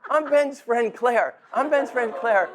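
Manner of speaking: put-on high, bubbly girlish voice with a laugh; bubbly young girl. Father at a wedding toast imitating Claire's eager greeting with a high laughing voice. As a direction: imitate an excited young woman meeting her friend's parents. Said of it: "cute little girl ... great big smile goes".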